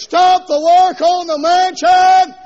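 slow, high, loud mock command; God as foreman. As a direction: order the builders back to work. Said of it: "Gotta erase".

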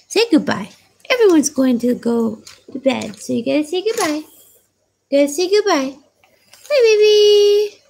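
high, playful coaxing. Real, not acted. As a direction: coo at the puppy to say goodbye. Baby talk to the puppy.

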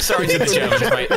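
mock apology.

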